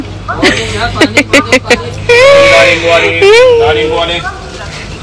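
a long, bright giggle that falls away; high laugh. Real, not acted. Teasing banter on the phone.

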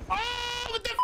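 ordinary delivery

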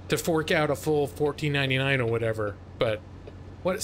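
usual speaking voice

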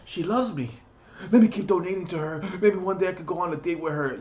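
high, fast, excited dopey voice; mock smitten fan. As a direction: gush like a lovestruck simp. Mocking smitten viewer.

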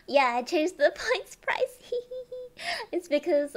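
loud, high, laughing admission; giggling. Real, not acted. Streamer owns up to viewers about the price change, giggling. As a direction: let giggles burst through as you speak up.

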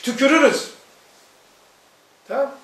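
usual speaking voice

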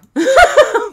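very loud, high burst of laughter; laughing. Real, not acted. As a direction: shriek with laughter. Laughs at new fire.

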